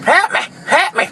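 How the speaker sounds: high desperate cry for help; screaming for help. Acted. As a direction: shriek it out, panicked. Opening clip cry for help.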